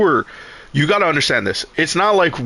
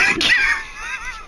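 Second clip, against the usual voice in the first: shrieking high laugh; burst of laughter; 4 dB quieter than usual. Real, not acted. Laughing at the crazy classroom story, shrieking laugh. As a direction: let a shrill laugh burst out.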